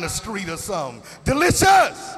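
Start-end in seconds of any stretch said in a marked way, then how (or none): 1.2-2.1 high, loud comic yell of delight; shouted delicious. Acted. Comic calling out a food as delicious, loud yell. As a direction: belt it out like a hungry fan.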